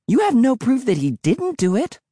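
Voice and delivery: high, nasty retort. A narrator's reading. Emily retorting to her brother, high and nasty. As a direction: throw it back nastily, pitched high. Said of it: "Emily replied nastily".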